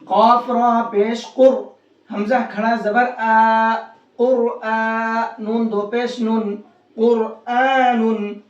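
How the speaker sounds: slow, drawn-out spelling to "कुरआनुन"; full R, long "aa" in "कुरआनुन"; heavy Ra. Shown as a demonstration. Teacher practising with students, heavy Ra recitation. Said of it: "इस रा को मोटा करके पढ़ेंगे".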